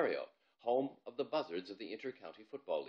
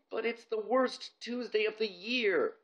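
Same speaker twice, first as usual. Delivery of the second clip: much higher, slow, other voice; whiny boy; 12 semitones higher, 4 dB louder, slower than usual. Acted. Bob whining on the ground.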